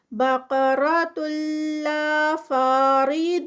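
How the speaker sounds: slow chanted recitation, heavy ق; Quran recitation. Shown as a demonstration. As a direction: recite drawn out. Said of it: "قا heavy".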